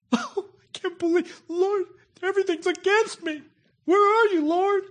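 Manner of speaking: high, crying, self-pitying wail; high tearful complaint, hammed-up; mock whining lament. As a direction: whine it to the Lord like everything's lost. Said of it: "you ever said that?".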